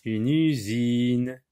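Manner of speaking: UNE USINE very slow, each vowel drawn out; mot étiré. Shown as a demonstration. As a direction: stretch USINE syllable by syllable. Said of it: "le mot usine commence par U". (« une usine » très lent, syllabes étirées)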